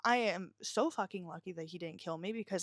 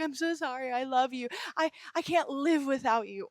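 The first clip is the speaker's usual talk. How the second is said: higher, pleading parody; mock love-bombing apology; 4 semitones higher than usual. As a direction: imitate an abuser begging after hurting her. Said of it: "love bomb you after and be like".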